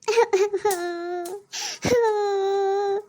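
long drawn-out sobbing wail; theatrical wail. Acted. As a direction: burst into a hammed-up bawl. Wife bursts into tears at husband.

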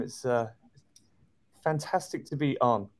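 usual speaking voice

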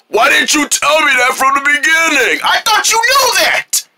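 high, shouted protest; indignant arguing. Acted. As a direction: squeal back defensively in a quarrel. Quarrel over who knew.

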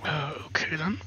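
whispering. Real, not acted. Muttering to self while exploring.

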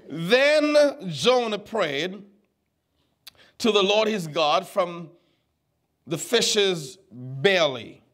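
slow deliberate reading. Shown as a demonstration. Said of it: "pastor reads scripture slowly, deliberately".

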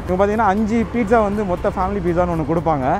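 ordinary delivery

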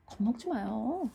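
soft, slow mock comfort; fake reassurance. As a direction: soothe him like a predator. Killer mock-soothes terrified captive. (소름 끼치게 다정한 달램)

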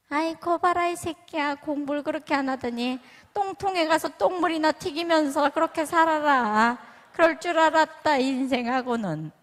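mock berating a son who failed; hammed-up angry mom cursing her kid; scornful scolding mother. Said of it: "이럴 엄마거든요".

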